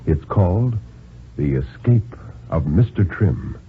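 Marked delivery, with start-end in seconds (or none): none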